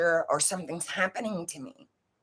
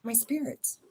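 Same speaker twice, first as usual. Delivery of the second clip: put-on much softer, a shy little kid explaining; small child answering; 2 semitones higher, 8 dB quieter than usual. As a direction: say it quietly like a child confessing. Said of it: "I said".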